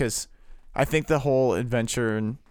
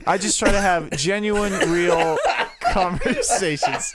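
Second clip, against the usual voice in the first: laughing; 4 semitones higher, 3 dB louder, slower than usual. Real, not acted. Laughing mid-sentence.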